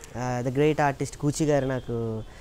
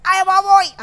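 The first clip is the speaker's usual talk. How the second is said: high, loud comic exclamation; Raj Babu voice; 12 dB louder than usual. Pretend. Mimic doing Raj Babu for his guru, high and loud. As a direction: burst out like the old comedian. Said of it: "Rajbabu గారు voice చేస్తారని".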